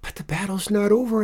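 high, quick, loud objection; protesting boy. A narrator's reading. Alfie objects to Benny leaving, high protesting voice. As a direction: protest like a child whose game is cut short.

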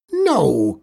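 put-on an old witch shouting no; shouted no. A narrator's reading. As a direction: shout it to stop her leaving. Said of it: "Critch shouted".

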